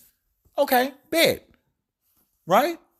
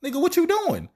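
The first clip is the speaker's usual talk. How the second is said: high, incredulous voice for the quoted figure; mocking Ezra's tone; 11 semitones higher than usual. Preacher voicing a figure's disbelief, high and incredulous. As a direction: throw the question up high in disbelief. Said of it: "He was like".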